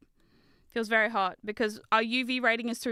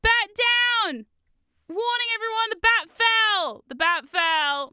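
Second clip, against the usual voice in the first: much higher, louder, slow emergency call; mock alarm call; 9 semitones higher, 6 dB louder, slower than usual.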